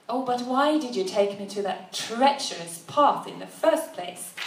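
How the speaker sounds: mildly accusatory demand; accusing. Acted. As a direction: accuse him with an edge. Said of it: "she asked accusingly".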